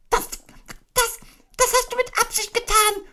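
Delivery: very high squeaky sputter; spluttering Piglet. Acted. Piglet spluttering accusations at Kanga after soap. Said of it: "prustete Ferkel".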